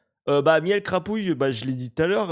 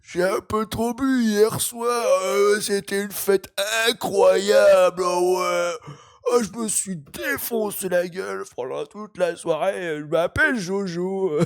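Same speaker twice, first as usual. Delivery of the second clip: slow, groggy, tipsy mock voice; giggly drunk Jojo; 4 semitones higher, slower than usual. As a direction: play him half-awake and a bit drunk. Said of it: "Et à huit heures, il fait quoi?".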